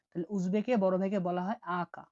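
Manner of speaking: ordinary talk, nothing marked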